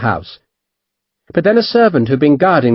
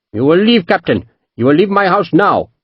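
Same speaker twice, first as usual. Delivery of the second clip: higher, firm commanding merchant; ordering out; 6 semitones higher than usual. A narrator's reading. Merchant ordering the captain out of his courtyard, firm. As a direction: order him out of your house.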